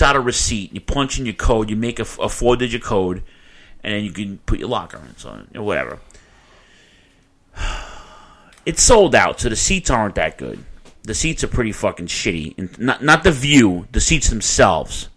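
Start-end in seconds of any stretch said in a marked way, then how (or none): none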